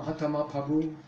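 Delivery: imitating a Chinese accent; Chinese-accented "Mahatma Prabhu". Teacher recalling devotees in China, Chinese accent. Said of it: "they have their own way".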